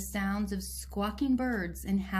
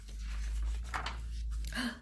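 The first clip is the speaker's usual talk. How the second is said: gasp; 3 semitones higher, 5 dB quieter than usual. Real, not acted. Reader gasps at the picture.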